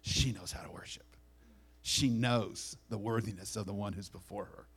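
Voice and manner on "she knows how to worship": hushed reverent whisper. Retold or imitated. Jesus telling the disciples what the woman knows, whispered. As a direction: breathe it out in awe.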